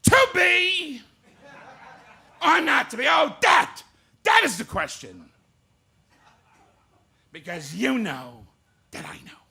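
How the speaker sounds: loud and high, a big hammy bellow; Jackie Gleason doing Shakespeare. Retold or imitated. Comic impersonating a TV star doing Hamlet, hammed-up bellow. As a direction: boom it out like a brash TV comic playing Hamlet. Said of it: "here is Jackie Gleason doing Shakespeare".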